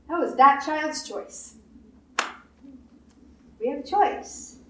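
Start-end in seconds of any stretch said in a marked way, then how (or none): none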